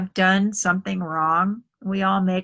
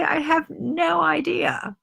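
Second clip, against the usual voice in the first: ordinary delivery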